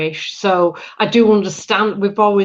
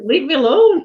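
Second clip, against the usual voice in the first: higher-pitched, playful protest; mock pestered plea; 6 semitones higher, 4 dB louder than usual. Singer besieged by fans of her accent, mock protest. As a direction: act out wanting some peace, jokingly. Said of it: "And it's like, you know".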